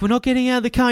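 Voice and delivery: high, strained insistence; panicked refusal. Retold or imitated. Passengers refusing to leave the taxi, high panicked. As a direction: dig in, scared but stubborn.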